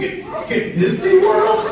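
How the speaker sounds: high, loud, drawn-out incredulous cry; shocked disbelief. Retold or imitated. Telling the guard how shocked he was, high and loud. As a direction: squeal it in total disbelief.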